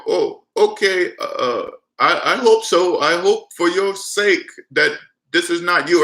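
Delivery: drawn-out, hesitant imitated Indian accent. Scammer warning the caller for their own sake, mock Indian accent. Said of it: "you hear that Indian voice".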